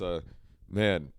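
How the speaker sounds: usual speaking voice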